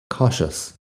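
"cautious" with the t as a soft sh, slow and hushed. Shown as a demonstration. Teacher showing learners that "cautious" sounds like "cau-shus". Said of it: "suena como si fuera S H".

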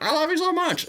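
gushing fan. Retold or imitated. Fan meeting Bill Hader, mock gushing squeal. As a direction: gush it like an overexcited fan. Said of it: "the guy that was like".

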